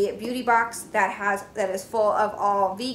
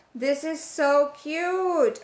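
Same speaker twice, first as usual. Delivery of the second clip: high, slow, drawn-out gush; delighted, lingering squee; excited gushing; 5 semitones higher, much slower than usual. Real, not acted. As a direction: coo over it, stretching the words high. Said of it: "I'm so excited".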